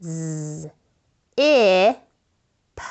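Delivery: Z, I, P spelled letter by letter with pauses; sounding out letters. Shown as a demonstration. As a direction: spell out Z, I, P one letter at a time. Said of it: "Sound it out with me".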